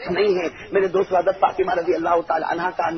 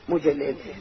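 much softer and lower; sudden hush; 6 semitones lower, 8 dB quieter than usual. Acted. The Prophet offering himself to his daughter, hushed. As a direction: drop to a tender hush.

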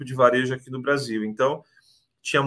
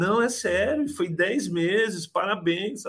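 imitating Amanda, much higher, slow on the news; happy congratulations; 7 semitones higher, slower than usual. Agent congratulating the applicant on his approval, imitated in a high voice.

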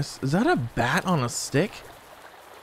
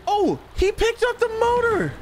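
shocked; 3 dB quieter than usual. Real, not acted. Shocked by enemy in game.

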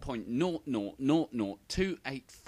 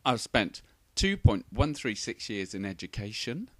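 normal talking voice

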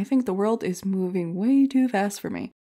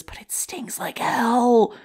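slow hushed whisper through the sting; pained whisper; 4 semitones higher, much slower than usual. Acted. Comic admitting the scrubber stung, slow pained whisper. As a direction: hiss it out slowly like it hurts.

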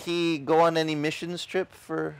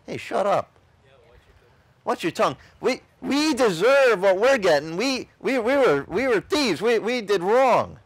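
a hint of a rough thief scolding his partner; lightly voiced thief, higher and louder, telling him off; rebuking thief; 6 semitones higher, 3 dB louder than usual. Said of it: "what did the one thief say".

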